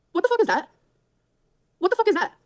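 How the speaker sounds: loud, high, indignant demand; outraged disbelief. Real, not acted. Streamer exposing a faked photo in loud outrage.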